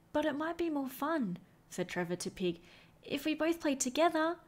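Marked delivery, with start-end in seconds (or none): 0.1-1.4 lightly higher, hopeful little dog; Trevor's voice. A narrator's reading. Trevor asking Pig to play together, lightly higher. 3.0-4.4 lightly higher, hopeful little dog; Trevor's voice. A narrator's reading. Trevor asking Pig to play together, lightly higher.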